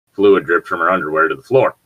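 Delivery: ordinary talk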